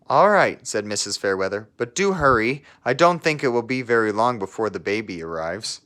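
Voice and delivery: put-on lively read-aloud voice for children; storybook narration. Teacher reading a picture book to her class, storybook narration. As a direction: tell the story to a class of kids.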